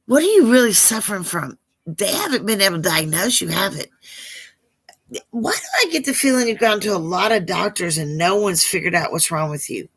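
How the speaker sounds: ordinary delivery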